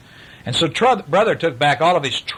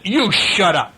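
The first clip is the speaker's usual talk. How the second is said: snapped; 6 semitones higher, 3 dB louder, slower than usual. Acted. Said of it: "snapped Sister".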